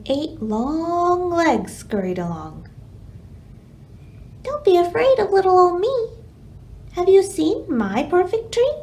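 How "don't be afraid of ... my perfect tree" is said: sweet-talking spider. Acted. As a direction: lure him in like a sly little spider. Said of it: "said a spider".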